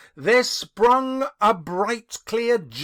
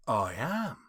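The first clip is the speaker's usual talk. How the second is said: soft, low ghostly answer; soft and gentle; 9 semitones lower, 8 dB quieter than usual. A narrator's reading. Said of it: "The voice was soft and gentle, singularly low".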